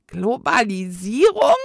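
"Globalisierung?" echoed loud with a rising question in the ghost voice; baffled ghost. A narrator's reading. Old ghost echoes the twins' word, baffled and rising. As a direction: repeat the strange word as a puzzled ghost.

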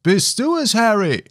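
very high, far-carrying questioning call. A narrator's reading. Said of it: "tönte es wieder herauf". (hoch heraufgerufen)

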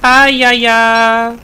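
high, slow, stretched put-on cry; goofy high cartoon shout; silly battle cry. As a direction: ham up a goofy war cry.

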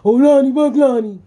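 high, loud sneering mock chant; loud jeering impression of the online haters. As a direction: ham up the haters' outrage to ridicule it.

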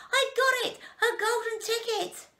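very high, loud cry of triumph; excited yell. A narrator's reading. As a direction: yell out the big find. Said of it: "one of my women workers yelled".

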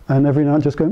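ordinary talk

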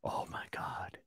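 whispered, barely there; hushed disbelief. Real, not acted. Podcaster struck by the book rescue idea, stunned whisper. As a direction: whisper it under your breath.

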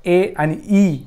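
ए as "eh", then ई as "ee"; separate, held ए and ई; two vowel sounds. Shown as a demonstration. Teacher showing both sounds of E, ए and ई held apart. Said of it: "ईचे दोन उच्चार केले जातात".

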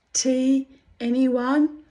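high, loud sing-out offer; playful tea-party host. Pretend. Playful Mad Hatter tea invitation.

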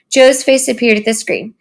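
ordinary delivery

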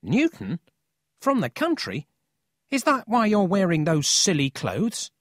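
high, incredulous teasing questions; cheeky boy. A narrator's reading. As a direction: poke fun at the newcomer. Paul mocks the country boy.

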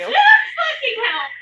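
slow loud scream-laugh. Real, not acted. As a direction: shriek it while laughing. Jump scare, laughing shout.

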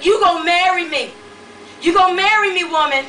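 loud demanding yell. A narrator's reading. Angry man yelling at the woman he wants to marry. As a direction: bark the demand at her. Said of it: "until he started yelling".

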